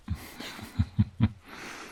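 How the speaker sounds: short amused laugh. Acted. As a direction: chuckle lightly to yourself. Amused by the knight.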